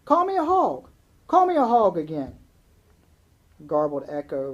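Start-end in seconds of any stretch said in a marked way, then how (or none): 0.0-2.4 loud, high yell. A narrator's reading. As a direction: throw it across the pasture, loud and high. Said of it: "she yelled".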